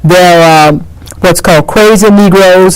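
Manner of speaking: usual speaking voice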